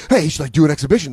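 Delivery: fast, rushed mock hype; coked-up hype. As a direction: rattle it off all wired up. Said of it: "coked up Sylvester Stallone".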